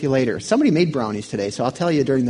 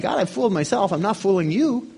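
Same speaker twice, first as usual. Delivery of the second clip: usual speaking voice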